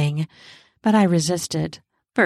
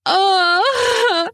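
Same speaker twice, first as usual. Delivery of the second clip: long high, strained groan; guttural groan; 12 semitones higher, 4 dB louder than usual. Acted. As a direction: let a drawn-out groan tear out. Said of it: "A guttural groan tore its way out".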